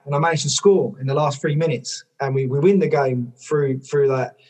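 normal talking voice

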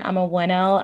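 usual speaking voice

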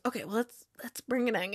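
hushed, retold coach talk; soft, tentative near-whisper. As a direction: drop the voice as if not to disturb. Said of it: "now he's whispering".

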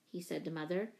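ordinary delivery

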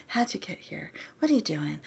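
whispering. Retold or imitated. Retelling character talking to body.

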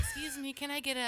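high-pitched imitation of a customer; mock customer politely butting in; interrupting customer. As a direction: pipe up high to get attention.

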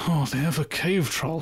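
a hint of a scared Boromir; lightly voiced fearful warning; mild mock dread. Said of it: "closes the door and goes".

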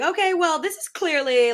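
high, loud retort, easing slightly; pointed comeback. Retold or imitated. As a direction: push back high and loud in a retold argument. Retold comeback in an argument.

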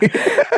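sudden high-pitched laugh. Real, not acted. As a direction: burst out laughing. Laughing at own topic.

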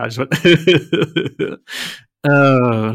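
drawn-out stoner laugh; Seth Rogen laugh. Retold or imitated. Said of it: "almost a Seth Rogen laugh".

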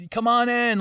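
high, animated call to come through; mock eager welcome. Cop ushering a crowd inside, mock eager welcome. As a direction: usher them in like an overfriendly cop. Imitating cops letting people in.

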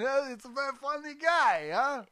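badly faked celebrity voice with a Russian tinge; Woody Allen. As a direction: push the voice up into a halting, hammy impression. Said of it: "like Christopher Walken doing Woody Allen".

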